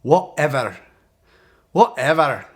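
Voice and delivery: loud Scottish-accented whatever; a Scottish accent, thrown out loud. Shown as a demonstration. Coach shows the password in a loud Scottish accent. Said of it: "let me say it in a Scottish accent".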